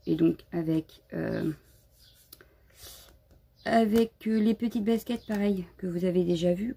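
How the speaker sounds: ordinary delivery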